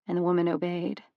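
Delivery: ordinary delivery, nothing marked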